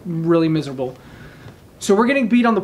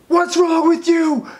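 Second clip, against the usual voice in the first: retold instructor yelling; 9 semitones higher than usual. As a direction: bark it like an instructor standing over a struggling trainee. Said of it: "they're screaming at me".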